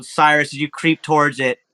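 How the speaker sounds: ordinary talk, nothing marked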